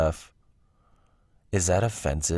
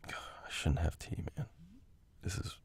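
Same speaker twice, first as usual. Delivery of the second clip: whispered aside, regretting the tea; rueful whisper; 9 semitones higher, 11 dB quieter than usual. Real, not acted. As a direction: drop to a whisper, half to yourself. Stomach upset, aside to self.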